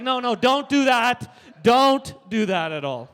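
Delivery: loud, slow alarmed warning; loud, slow warning to his mom. Retold or imitated. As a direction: stop her before she breaks it.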